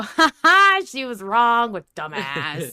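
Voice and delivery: a loud, high "ha ha" jeer that slows down into a sneer; mock jeering. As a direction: play the gloating internet crowd mocking her. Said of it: "everyone can point and go".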